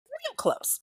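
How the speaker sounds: very high, insinuating squeak on real close; hint at the scandal with a sly high squeal; suggestive squeak. Real, not acted. Suggestive gossip punchline.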